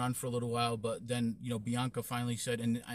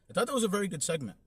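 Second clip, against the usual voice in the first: higher and louder, racing through the praise; excited praise; 9 semitones higher, 5 dB louder, faster than usual. Real, not acted. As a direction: gush about the segment, fast. Eager praise of the segment.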